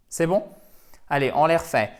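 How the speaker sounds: usual speaking voice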